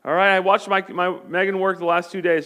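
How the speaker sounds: normal talking voice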